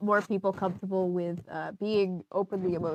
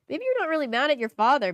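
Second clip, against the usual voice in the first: normal talking voice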